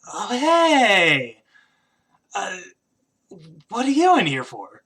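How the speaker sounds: put-on loud, high-pitched drunken greeting; woozy drunk, voice swooping up high; drunk man. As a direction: blurt it out like a tipsy drinker caught off guard. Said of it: "he's drinking these by the bottle".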